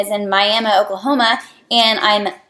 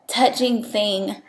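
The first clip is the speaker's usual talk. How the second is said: getting emotional; 6 dB quieter, much slower than usual. Real, not acted. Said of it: "Sorry, I hate to get emotional".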